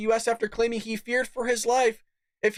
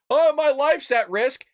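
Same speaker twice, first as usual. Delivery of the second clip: slow, louder, higher mock whine; 6 semitones higher, 4 dB louder, slower than usual. Host mocking migrants' claims to the audience, high slow mock whine. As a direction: sneer it like a fake sob story.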